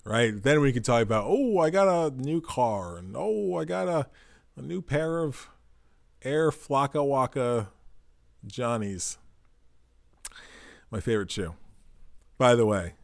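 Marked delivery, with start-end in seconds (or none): 1.3-9.2 drawn-out, higher mock gushing; mock-impressed show-off; mock boasting. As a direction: coo over shiny new possessions, in jest. Mock boasting about new purchases.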